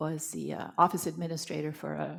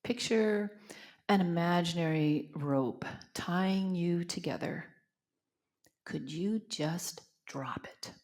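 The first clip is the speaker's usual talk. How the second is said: guided meditation. Acted. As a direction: guide them gently into reflection. Leads silent contemplation.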